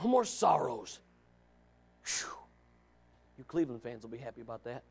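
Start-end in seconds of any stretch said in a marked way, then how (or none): none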